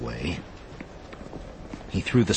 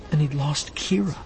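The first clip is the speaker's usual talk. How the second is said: whispered grief; 8 semitones higher than usual. A narrator's reading. Narrator's grief at losing Kira.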